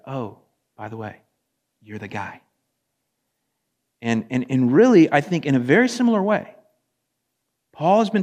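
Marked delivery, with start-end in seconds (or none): 0.1-2.4 soft, low, offhand aside; understated reveal. Retold or imitated. Prophet confronting the guilty king, quietly understated. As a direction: drop it quietly and casually, with pauses. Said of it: "Nathan's like".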